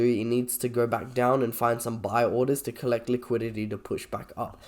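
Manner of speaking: normal talking voice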